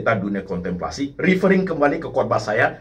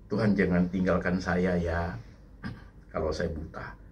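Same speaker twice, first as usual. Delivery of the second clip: low, soft plea to God; quiet pleading prayer; 7 semitones lower, 8 dB quieter than usual. Retold or imitated. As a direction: pray it softly, almost to yourself. Murmured prayer to God in retelling.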